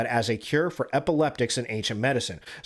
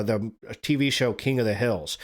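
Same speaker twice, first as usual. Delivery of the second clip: ordinary talk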